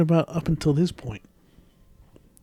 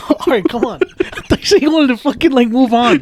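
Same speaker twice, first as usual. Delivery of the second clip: laughing; 8 semitones higher, 6 dB louder than usual. Real, not acted. Laughing while protesting.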